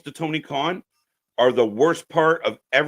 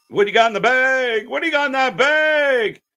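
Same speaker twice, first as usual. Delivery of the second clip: mocking wrestler voice; 6 semitones higher, 5 dB louder than usual. Voicing wrestler on the show.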